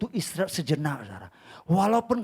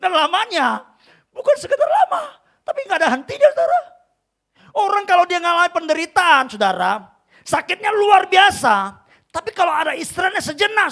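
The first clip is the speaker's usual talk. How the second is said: shouted, high-pitched sermon climax; very loud, emphatic exhortation; full-force preaching; 11 semitones higher, 10 dB louder than usual. Acted. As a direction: build to a pulpit shout.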